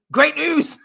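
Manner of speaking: high, loud cheer; hammed-up eager cheer; mock excitement. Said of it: "Tony jumps in".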